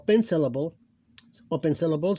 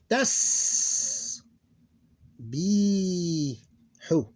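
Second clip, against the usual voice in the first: drawn-out hissing s, then a long pause; time to stand out; 2 semitones higher, much slower than usual. Shown as a demonstration. Teacher showing sukoon needs time, slow and drawn out. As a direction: stretch each sound and separate the syllables widely. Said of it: "give it enough time to stand out".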